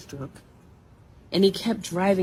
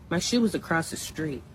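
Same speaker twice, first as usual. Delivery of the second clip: ordinary talk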